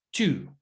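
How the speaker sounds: two with a near ch sound; two said almost like chu; English two. Shown as a demonstration. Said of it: "Es como si fuera una C H". (two casi con CH)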